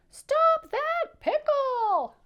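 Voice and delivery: very high, loud, long-drawn holler; crying-out pretzel. A narrator's reading. Pretzel joins the chase, high loud drawn-out cry. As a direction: cry out as a pretzel in hot pursuit. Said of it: "cried the pretzel".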